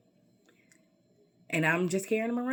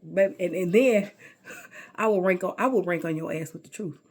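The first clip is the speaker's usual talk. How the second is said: normal talking voice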